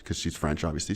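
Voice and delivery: usual speaking voice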